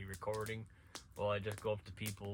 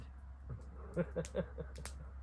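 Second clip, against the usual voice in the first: laughs; 6 semitones higher, 4 dB quieter than usual. Real, not acted. Laughing at own story.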